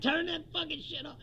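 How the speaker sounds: angry demand. Retold or imitated. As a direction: snap at mom to kill the TV. He snaps at mom.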